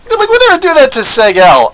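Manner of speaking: high, loud put-on peasant cry; mock peasant voice. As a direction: imitate a riled villager. Imitating peasants turning on someone.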